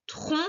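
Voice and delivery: "trompe" in two beats, trom-pe; "trompe" slowly divided, trom / pe; split into syllables. Shown as a demonstration. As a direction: sound out "trompe" with the final e as its own syllable. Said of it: "tu diras la première syllabe de chaque mot".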